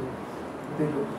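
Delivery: slow breathy whisper; whispered, drawn-out showing; hushed reveal. A narrator's reading. Dramatic reveal of torture scars.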